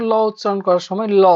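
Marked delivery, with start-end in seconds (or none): 0.0-0.1 ল said as a clear lateral la; demo of la. Shown as a demonstration. Teacher showing students how ল sounds. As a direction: hold the ল with air past both sides of the tongue. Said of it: "খেয়াল করে দেখো".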